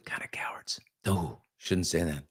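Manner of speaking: hushed, guilty whisper; whispered aside. Real, not acted. Host whispering a cheeky insult to the audience, hushed. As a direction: drop to a whisper as if you shouldn't say it.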